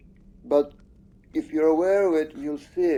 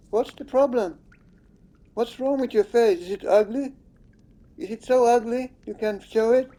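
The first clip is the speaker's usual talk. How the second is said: higher, harsh jeering at the character; real taunting, pitched up and scornful; angry taunting; 6 semitones higher than usual. Real, not acted. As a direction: demand she show her face. Taunting the game character angrily.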